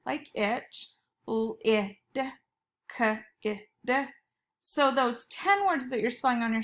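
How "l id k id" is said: each sound pulled apart slowly; teacher segmenting lag and gas; sounding out letters. Shown as a demonstration. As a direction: stretch out every sound for the class. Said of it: "have the I, like itch".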